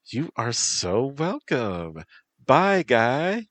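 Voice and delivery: put-on high, bubbly valley girl goodbye. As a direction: chirp a valley girl farewell. Bee girl says goodbye.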